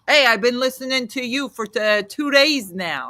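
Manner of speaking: mock a brash New Jersey radio caller; imitating Jersey caller. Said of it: "this is how New Jersey radio goes".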